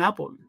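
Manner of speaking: APPLE said as "Á-pol"; APPLE with a rounded o in the second syllable; British pronunciation. Shown as a demonstration. Host shows how the British say it, pronunciation demo. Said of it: "los británicos dicen Apple".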